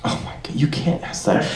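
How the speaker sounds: hushed, breathless oh my God; whispered playful panic; whispered mock alarm. Real, not acted. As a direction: whisper it like a forbidden question.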